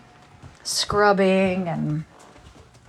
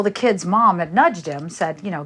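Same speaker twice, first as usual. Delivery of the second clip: usual speaking voice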